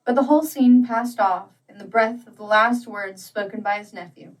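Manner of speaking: ordinary delivery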